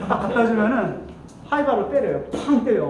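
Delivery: ordinary talk, nothing marked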